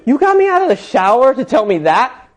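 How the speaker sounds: high, loud, outraged question; hammed-up exasperated outburst; indignant. Woman in towel scolding driver, indignant.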